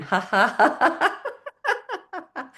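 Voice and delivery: ha's climbing high; playful mental-floss laugh; exercise laughter. Shown as a demonstration. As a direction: laugh on purpose, rising in pitch. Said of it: "we're gonna clean it like this".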